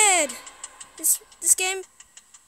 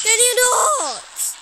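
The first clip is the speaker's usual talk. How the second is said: high, slow, drawn-out whine; exasperated plea; 5 semitones higher, 4 dB louder, much slower than usual. Real, not acted. Streamer begging the game to stop, whining. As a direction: stretch it out, pleading with the game.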